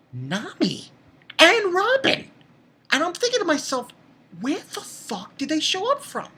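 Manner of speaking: high-pitched, incredulous outburst; astonished. Real, not acted. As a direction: voice leaps up in bafflement. Astonished at surprise arrivals.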